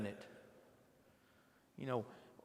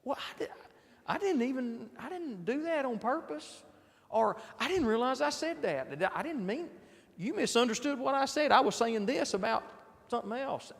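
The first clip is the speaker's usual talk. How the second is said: a lightly voiced flustered excuse, higher; defensive excuse; 8 semitones higher than usual. Preacher imitates someone insisting it was a misunderstanding, higher and flustered. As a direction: play the other person protesting innocence, pitched up. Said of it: "what happens?".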